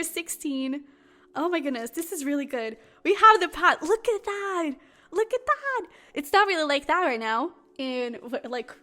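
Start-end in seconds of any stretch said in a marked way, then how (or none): 3.0-3.8 excited. Real, not acted. Excited by the card. 3.8-4.7 delighted look at that. Real, not acted. Marvelling at the card. 5.1-5.9 more excited. Real, not acted. Repeats, even more thrilled.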